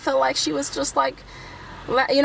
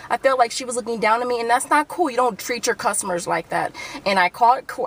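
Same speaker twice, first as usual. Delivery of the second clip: ordinary delivery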